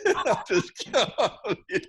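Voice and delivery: high, loud, cracking up while talking; amused mock alarm, laughing hard; laughing disbelief. Real, not acted. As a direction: protest through helpless laughter. Laughing as he acts his alarm.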